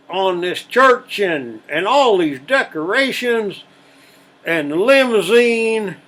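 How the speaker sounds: high, loud building rant about the money; outraged father ranting. Acted. Father ranting about wedding costs.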